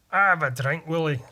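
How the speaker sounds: broad Scots catchphrase delivery; Scottish accent. Acted. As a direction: warm up into a Scottish brogue. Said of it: "That's my Scottish phrase".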